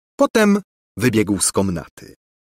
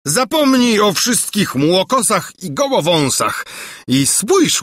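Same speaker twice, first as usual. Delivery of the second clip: a put-on doting king's voice, higher; amorous old king; 6 semitones higher than usual. A narrator's reading. King wooing the maid, doting. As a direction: flatter her grandly.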